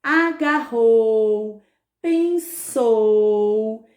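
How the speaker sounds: agarrou and pensou drawn out to the final u; very slow agarrou, pensou with long endings; strong final U. Shown as a demonstration. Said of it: "O U tem som forte".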